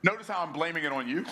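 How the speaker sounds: ordinary talk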